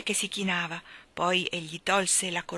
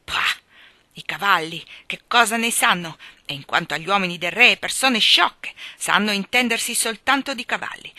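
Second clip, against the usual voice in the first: loud, dismissive scoffing; scornful; 2 semitones higher, 7 dB louder than usual. A narrator's reading. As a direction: pour contempt on the foolish lot. Offended little man scoffs at them.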